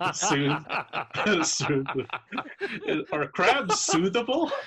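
high, loud, helpless laughing speech; real laughter breaking through the words. Real, not acted. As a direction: crack up while repeating it incredulously. Game master cracks up at player's idea.

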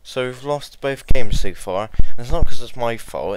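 shouting in frustration; loud frustrated rant. Real, not acted. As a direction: yell it, fed up.

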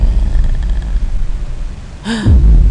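long, high, breathy gasp of surprise; sharp gasp. Real, not acted. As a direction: gasp at a sudden discovery. Sudden discovery in game.

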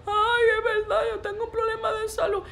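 high, wailing fake sobs; mock crying. Preacher mocking believers who weep at prophecies, mock crying. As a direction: imitate a gullible believer whining tearfully. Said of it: "vienen ustedes a estar llorando".

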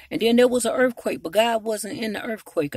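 usual speaking voice

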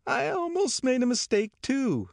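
very high, shrill society wife. A narrator's reading. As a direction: declare it vigorously, pitched right up. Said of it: "the shrill voice of Missus McKee".

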